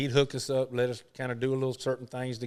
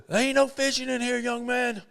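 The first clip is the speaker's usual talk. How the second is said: imitated older man, higher and firmer; Arnold Palmer repeating himself; 10 semitones higher than usual. Palmer repeating his warning to the young fisherman, imitated. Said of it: "And he says".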